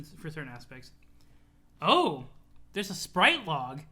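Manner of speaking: usual speaking voice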